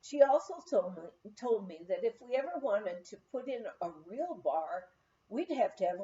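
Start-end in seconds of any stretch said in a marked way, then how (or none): none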